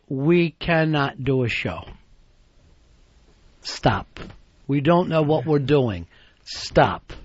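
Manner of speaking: low, halting dictation with long pauses; telegram dictation. Acted. Co-host dictating a message to Wiki, halting telegram style. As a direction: read it out like a telegram, each STOP dropped low. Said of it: "We sending him an email or a telegram?".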